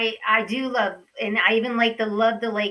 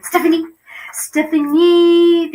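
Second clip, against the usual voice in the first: drawn-out announcer call; 5 dB louder, slower than usual. Real, not acted. Host announcing her guest, big drawn-out fanfare. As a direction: hold it long like a ring announcer.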